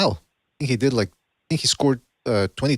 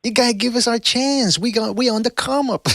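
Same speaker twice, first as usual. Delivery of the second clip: high-pitched pleading imitation; hammed-up mimicry of a hustling dad; mimicking a father-trainer; 11 semitones higher than usual. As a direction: plead the case in a put-on high voice. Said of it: "when he goes".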